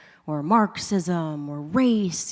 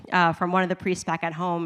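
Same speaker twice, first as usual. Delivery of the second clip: usual speaking voice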